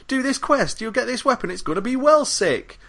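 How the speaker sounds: put-on very high-pitched British boy caricature; Pickle. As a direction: pipe it up in a silly English kid voice. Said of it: "how Americans think British people talk".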